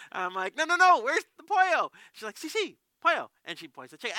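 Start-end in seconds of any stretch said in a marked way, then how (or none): 0.6-1.9 high, very loud corrective plea; exasperated insisting. Retold or imitated. Visitor asks again for the washroom, exasperated. Repeats request, not understood. 2.4-3.3 high, loud, insistent reply; eager agreement. Retold or imitated. Woman confirms her answer to the visitor, eagerly agreeing.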